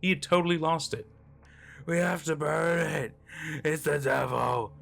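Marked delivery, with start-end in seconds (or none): 1.9-4.7 strained, raised wail, cut off abruptly; frantic screaming. A narrator's reading. Hutch pounding on the trunk, screaming hysterically. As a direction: wail the warning over and over. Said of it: "still screaming".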